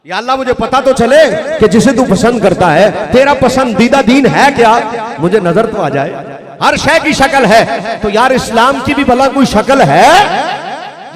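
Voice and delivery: loud, impassioned plea to Allah; पुरज़ोर ख़िताबत. Acted. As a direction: cry out to God like a preacher at full force. Preacher pleads loudly to Allah. (बुलंद आवाज़ में अल्लाह से फ़रियाद)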